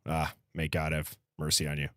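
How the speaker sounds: normal talking voice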